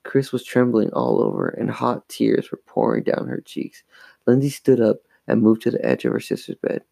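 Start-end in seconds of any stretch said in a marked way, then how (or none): none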